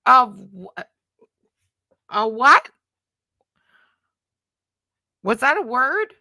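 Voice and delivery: loud, rising disbelief; reacting to a made-up word, loud and incredulous; baffled surprise. Real, not acted. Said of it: "Was that, like, an actual word?".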